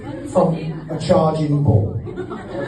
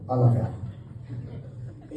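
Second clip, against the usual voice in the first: soft whispered aside; 4 semitones lower, 9 dB quieter, much slower than usual. Real, not acted. Affectionate aside to brother.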